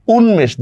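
উন্মেষ as "un-mesh"; sounded m. Shown as a demonstration. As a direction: keep the m audible after n in উন্মেষ. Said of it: "মফলার যেহেতু উচ্চারণ হচ্ছে".

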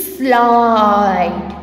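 "slide" low and stretched on the vowel; pronunciation demo. Shown as a demonstration. Teacher blending S L I D E, drawn-out demo. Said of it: "How we will pronounce it?".

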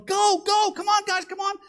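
high-pitched loud yell; urgent shouting. Acted. Father rushing family downstairs before a tornado, urgent shouting. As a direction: holler to get everyone moving.

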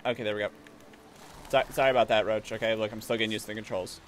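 usual speaking voice

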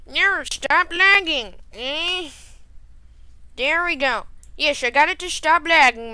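high, loud whiny nagging; put-on high squawk of annoyance; annoyed voice. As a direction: whine loudly to annoy people. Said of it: "It's my annoyed voice".

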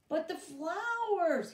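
high, loud, slow protest; dismayed protest. Acted. Boy to boss, high dismayed protest. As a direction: plead that they're flowers.

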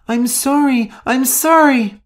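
very high, frightened cries; terrified pleading. Acted. Shu under attack by Rosa, terrified pleading.

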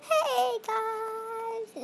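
high, drawn-out mock-excited call; exciting greeting. Shown as a demonstration. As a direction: greet viewers in a put-on excited voice. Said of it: "I can't do it very exciting".

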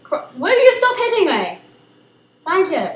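high, loud complaint; annoyed protest. Real, not acted. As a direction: tell them off sharply. Being hit in game.

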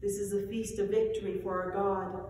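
ordinary delivery

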